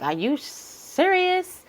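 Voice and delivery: slow, high, drawn-out disbelief. Retold or imitated. Asking someone in slow high mock disbelief. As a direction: stretch it out high like you can't believe it. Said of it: "sometimes I be like".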